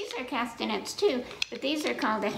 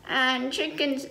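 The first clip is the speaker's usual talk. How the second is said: plain reading voice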